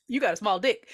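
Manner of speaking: put-on taunting voice; mocking voice. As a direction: tease in a different voice. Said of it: "a funny, like".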